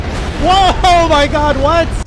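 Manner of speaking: high, loud startled whoa; loud, high shocked outburst; shocked shout. Retold or imitated. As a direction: yell in shock as the boss goes berserk. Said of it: "And I'm like".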